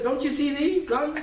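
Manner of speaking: loud, high-pitched show-off challenge; mock tough guy. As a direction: play a muscleman boasting for laughs. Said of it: "was a husky person, you know, strong".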